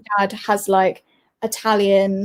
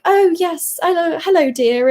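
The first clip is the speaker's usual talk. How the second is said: mock posh British; 7 semitones higher than usual. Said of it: "British people just sound so".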